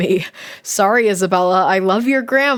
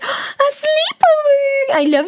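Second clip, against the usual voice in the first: high, slow gasp of delight; gasping excitement; slower than usual. Acted. Streamer reading diary, gasping with delight. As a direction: gasp it out thrilled.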